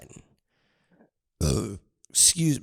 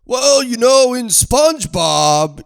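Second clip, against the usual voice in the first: loud, high pompous voice; mock know-it-all; 10 semitones higher, 6 dB louder than usual. Answering the sauna old man, loud and mock-pompous. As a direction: deliver the two cents grandly. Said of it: "I threw in my two cents".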